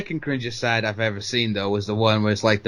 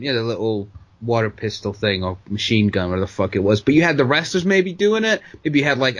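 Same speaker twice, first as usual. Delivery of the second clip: normal talking voice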